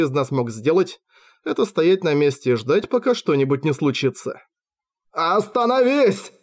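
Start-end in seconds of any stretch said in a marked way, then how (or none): 5.1-6.3 pleading. A narrator's reading. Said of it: "взмолился Кристофер, его голос дрожал".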